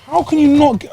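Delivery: high outraged complaint; exasperated. Real, not acted. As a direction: pitch it up in disbelief. Complaining about missing spoons.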